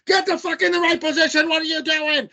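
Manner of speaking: high-pitched mock coach yell; Greg Berhalter. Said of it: "Greg Berhalter's like".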